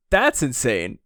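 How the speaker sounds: ordinary talk, nothing marked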